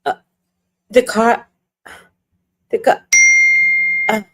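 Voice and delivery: dumbfounded, long gaps between fragments; stunned realisation. Acted. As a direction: stammer in dawning disbelief. Acted stunned realisation about the tires.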